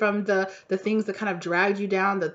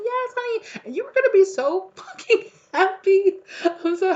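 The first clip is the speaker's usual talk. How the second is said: much higher and louder, laughing through it; thrilled laughing burst; 12 semitones higher, 5 dB louder than usual. Real, not acted. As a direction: burst out with real joy and a laugh.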